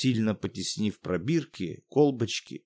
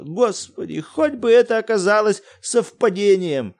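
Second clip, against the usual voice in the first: high, loud panicked outcry; бессвязный крик; incoherent shouting; 7 semitones higher, 5 dB louder than usual. Acted. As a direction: shout to no one in despair. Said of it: "Бессвязно выкрикивал".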